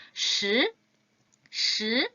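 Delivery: 시 with the tongue curled back; 시 said as shi, tongue to the palate; 시 modeled as a curled shi; retroflex shi. Shown as a demonstration. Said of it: "같이 읽어볼게요".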